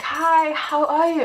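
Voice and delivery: high-pitched, eager imitated hello; high singsong mimicked greeting. Imitating students greeting her.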